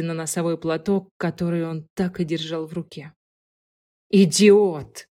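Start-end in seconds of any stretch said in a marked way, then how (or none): 4.1-5.1 loud, punchy self-insult; brand yourself with it, loud and final; emphatic self-rebuke. A narrator's reading. Man cursing himself over the truth he missed, emphatic and loud. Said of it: "припечатал сам себя".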